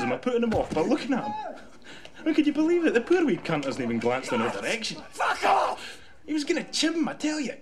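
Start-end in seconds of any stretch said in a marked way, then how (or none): 5.1-6.0 high loud furious yell; angry shout. Acted. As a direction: roar it at him. Begbie threatening a man.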